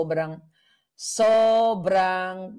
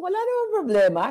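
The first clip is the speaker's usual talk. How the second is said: much higher and louder, quick and airy; sing-song, fast mock-innocent reply to the senator; breezy quoted reply; 13 semitones higher, 5 dB louder, faster than usual. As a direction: toss it off sweetly as if nothing happened. Own quoted reply to Robin.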